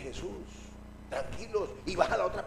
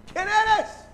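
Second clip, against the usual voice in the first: high, loud demand; shouted challenge; 12 semitones higher, 9 dB louder than usual. Acted. Pedro challenges the figure on the water, high shouted question. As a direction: yell the question across the water.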